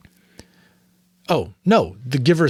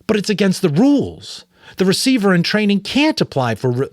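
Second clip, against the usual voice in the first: higher, louder urgent objection; alarmed protest; 10 semitones higher, 7 dB louder than usual. Acted. Jonas objecting to the Giver, alarmed, higher and louder. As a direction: blurt it out, shocked at the broken rule.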